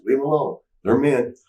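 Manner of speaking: lightly acted objection; mock protest. Acting out Roddy holding girls back.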